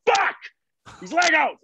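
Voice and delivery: mock toddler tantrum, high and loud; frustrated little girl. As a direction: squeal it like a five-year-old fed up with toys. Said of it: "she's like".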